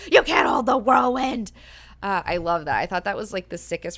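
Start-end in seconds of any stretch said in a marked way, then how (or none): 0.1-1.5 shouted and high, ripping away; tearing away from a grabbing man, shouting; defiant shout. Pretend. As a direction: yell it as you tear free. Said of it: "rip away and then just be like".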